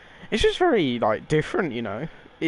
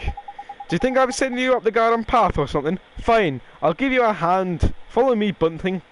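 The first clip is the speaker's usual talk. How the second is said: fairy sidekick in a loosely faked Irish accent; amateur accented game-character voice; cheeky fairy, exasperated; 4 semitones higher than usual. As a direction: bicker like a cocky little fairy with a wobbly accent.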